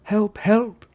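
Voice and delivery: high, urgent distant plea; high-pitched help call; cry for help. A narrator's reading. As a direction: cry out for rescue. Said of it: "then a cry that sounded like".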